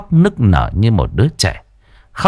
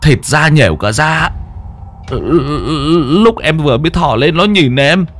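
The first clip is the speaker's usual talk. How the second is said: higher, shaky frightened account; trembling retelling; 7 semitones higher than usual. Acted. Henchman to boss describing the ghost, trembling. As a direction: relive the horror as you tell it. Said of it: "run run kể lại".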